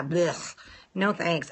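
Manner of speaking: normal talking voice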